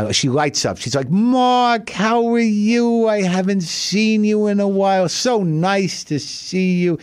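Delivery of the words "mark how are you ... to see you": put-on high, slow sweet old lady voice; high, sugary little old Italian lady; sweet old neighbor. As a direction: coo like a doting elderly neighbor. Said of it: "the sweetest little woman".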